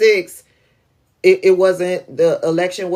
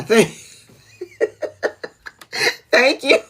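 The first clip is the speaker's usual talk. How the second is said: laughing thanks; 14 semitones higher than usual. Real, not acted.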